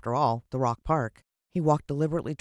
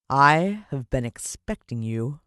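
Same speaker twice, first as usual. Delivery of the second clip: slow, louder sinister villain voice; menacing villain; 6 dB louder, slower than usual. Acted. Mister Motcher to the intruding boy, slow menace. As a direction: savour each word like a smug villain.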